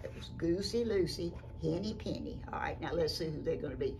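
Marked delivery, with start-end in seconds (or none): none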